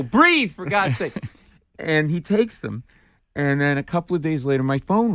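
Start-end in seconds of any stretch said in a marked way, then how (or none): 0.0-1.1 exasperated plea. Retold or imitated. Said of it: "You just wanna go".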